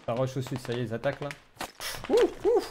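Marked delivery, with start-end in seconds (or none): 2.1-2.6 startled yelp. Real, not acted. Reacts to incoming fire.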